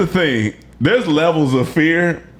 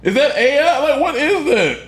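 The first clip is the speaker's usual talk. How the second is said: baffled and spooked, voice climbing up; stunned disbelief; 11 semitones higher than usual. Real, not acted. Friend reacting to python video, high stunned disbelief.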